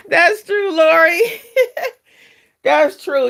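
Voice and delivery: loud, high, through laughter; laughing agreement. Real, not acted. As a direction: laugh hard while agreeing. Laughing at a viewer's comment.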